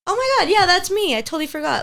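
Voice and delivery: high, loud, bright mock surprise; mock surprised realization. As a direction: play the startled 'oh that's me' moment. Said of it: "I got so used to, like".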